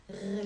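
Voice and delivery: long R rolled at the back of the throat; French gargled r. Shown as a demonstration. Teacher comparing to French, gargled R. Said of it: "like the French sound".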